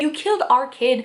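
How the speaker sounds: usual speaking voice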